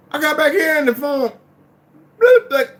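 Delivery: high, worked-up storytelling; excited retelling. Retold or imitated. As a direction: tell it all wound up. Retelling the phone suddenly ringing.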